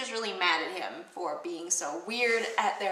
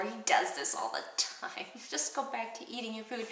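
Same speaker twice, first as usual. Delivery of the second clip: hushed whisper as Lady Macbeth, with a laugh; mock whisper; 7 dB quieter than usual. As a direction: whisper it aside to the guests. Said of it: "So he's like".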